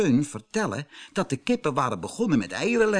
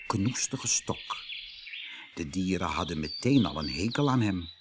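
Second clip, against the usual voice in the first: ordinary delivery, nothing marked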